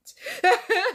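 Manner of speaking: high, loud burst of laughter; real amused cackle. Real, not acted. Amused at own commentary.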